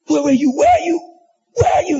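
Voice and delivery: mock panicked where-are-you; frantic calling. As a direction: call out for the missing student, pitch soaring. Mock frantic search for a classmate.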